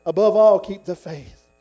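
loud punch on ABOVE ALL, then dropping off; forceful exhortation. Acted. Preacher urging the church after Paul's words, forceful exhortation. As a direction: hit "Above all" hard, let "keep the faith" fall.

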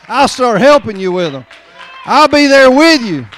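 loud, high, driving preacher's cadence; full-force preaching. Acted. Preacher exhorting the congregation not to judge, full force. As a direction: preach it out, pushing every line.

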